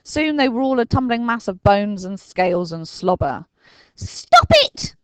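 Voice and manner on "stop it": high, loud cry to stop; cried out. A narrator's reading. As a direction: shout him to a halt. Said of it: "cried Dinking".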